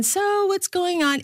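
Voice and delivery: high, light imitation of a chatty mom; mock chatty mom. Host imitating what her mother never says on the phone, high mock voice. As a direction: play the mom who never chats. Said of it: "it's never been".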